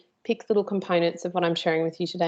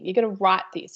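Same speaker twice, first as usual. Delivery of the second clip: ordinary talk, nothing marked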